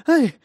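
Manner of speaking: short high squeal of pain; yelp. Acted. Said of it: "взвизгнула Силия".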